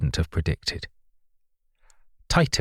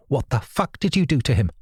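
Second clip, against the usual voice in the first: raised, furious accusation; narrated angry shout; 5 semitones higher than usual. A narrator's reading. Narrator confronting Rachel in the bedroom, angry shout. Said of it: "I shouted at her".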